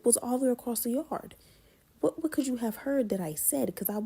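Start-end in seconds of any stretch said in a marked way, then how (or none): none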